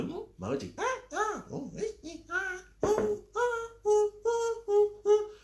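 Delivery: high squeaky emoji grunts; mock wordless babble; high nonsense noises mocking emoji replies; silly noises. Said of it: "not like a".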